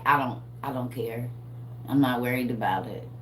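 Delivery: low, lazy, don't-care voice; unbothered stoned calm. Pretend. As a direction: play it like you're mellow from smoking all day. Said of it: "It's like you smoking all day".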